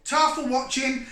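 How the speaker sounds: upbeat, slow, bright farewell; cheery sign-off. Real, not acted. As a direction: call out a cheerful goodbye.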